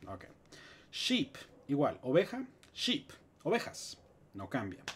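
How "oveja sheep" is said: usual speaking voice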